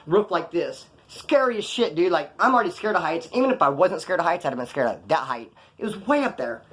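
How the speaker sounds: ordinary talk, nothing marked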